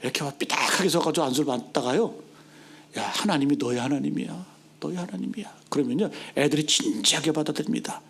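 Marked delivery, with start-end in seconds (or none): 3.0-5.6 low voice dropping to a whisper; tender reassurance. Acted. Pastor praying over a sullen child, tender and hushed. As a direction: soften the repeat into a hush.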